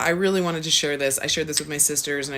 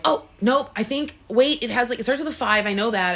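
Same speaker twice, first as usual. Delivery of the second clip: excited recall; 8 semitones higher, faster than usual. Retold or imitated. Inner voice straining to remember.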